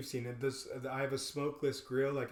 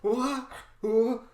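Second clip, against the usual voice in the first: high, louder stammering shock; lost for words; loud laughing stammer; stunned; 9 semitones higher, 10 dB louder, much slower than usual. Retold or imitated. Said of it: "they're like".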